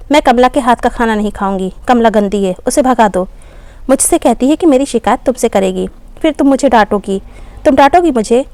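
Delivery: usual speaking voice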